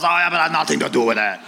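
breezy denial in a put-on Burrows accent. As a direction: teammate shrugging off the proof in a laughing Burrows accent. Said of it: "He cannot do a Burrows conversation without the accent".

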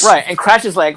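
ordinary delivery

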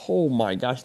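usual speaking voice

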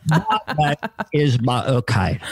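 Cockney impression demo; Michael Caine. Shown as a demonstration. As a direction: show the Cockney accent lightly. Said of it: "I think I'm Michael Caine".

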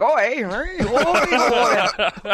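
high, loud hammed-up accent mockery; mock Canadian oi. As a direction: tease him with a silly loud accent. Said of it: "All of a sudden, you're like".

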